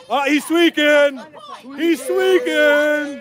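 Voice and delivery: slow, drawn-out mockery; mocking taunt. Real, not acted. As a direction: stretch it out to jeer at him. Taunting a man on camera.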